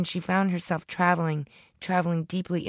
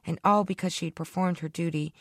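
plain reading voice

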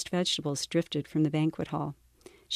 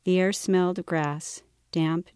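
usual speaking voice

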